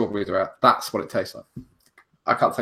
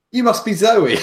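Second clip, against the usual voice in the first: a flustered, eager blurt, higher and louder; 10 semitones higher, 7 dB louder than usual. Retold or imitated. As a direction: blurt it out nervously on meeting someone. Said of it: "I got flustered and said something like".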